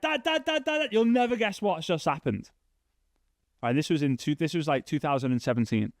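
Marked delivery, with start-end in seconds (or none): 0.0-2.5 high, eager calls to dad; excited kid shouting for dad; excited calling. Retold or imitated. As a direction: burst in with news, calling out.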